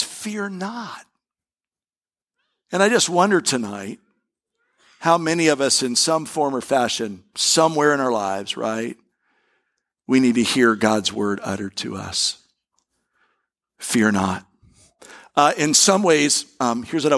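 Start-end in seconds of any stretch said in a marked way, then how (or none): 0.0-1.1 much softer, hushed "Fear not"; hushed reverence. Acted. Climax of contrast, hushed. 13.8-14.5 low, gentle "Fear not"; gentle assurance. Acted. Quiet repeat to congregation.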